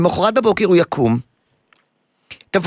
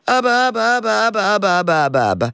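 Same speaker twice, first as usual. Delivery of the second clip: higher whiny call for daddy, easing down; mock crying child; 4 semitones higher than usual. Therapist imitating crying son in his room, mild mock wail. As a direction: wail for dad half-heartedly. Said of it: "והוא בוכה".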